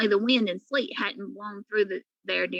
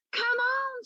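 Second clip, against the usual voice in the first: high call down the slope; cat calls down the icy slope; 12 semitones higher than usual. A narrator's reading. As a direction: coax the pig down the ice. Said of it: "she called".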